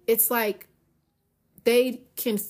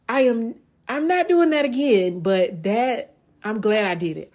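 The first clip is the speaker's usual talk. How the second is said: mock shaken exclaim; 2 semitones higher, 5 dB louder than usual. Rider stepping off the coaster to friends, loud mock-shaken exclaim. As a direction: blurt it out like you barely survived.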